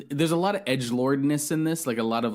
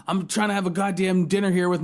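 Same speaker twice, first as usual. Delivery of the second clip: mock angry sheriff; 7 semitones higher, 3 dB louder, faster than usual. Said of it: "He's like".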